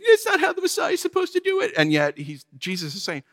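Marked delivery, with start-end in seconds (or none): 0.0-1.7 high, fast whining mock; whiny Pharisees. Jesus quotes whining Pharisees, high and whiny. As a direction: sneer it in a high whine.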